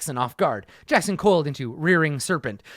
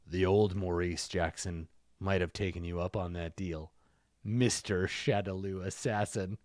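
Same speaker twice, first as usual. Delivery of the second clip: low, soft, steely threat to the assassin; a quiet promise of revenge, voiced low; low quiet vow; 9 semitones lower, 8 dB quieter than usual. Jackson to the assassin over his dead master: low, quiet, steely.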